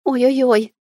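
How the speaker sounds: pained moaning in labour. A narrator's reading. Woman in labour moaning on the turns. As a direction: moan through a contraction. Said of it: "стонала на поворотах Людмила".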